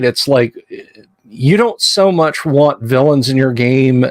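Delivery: usual speaking voice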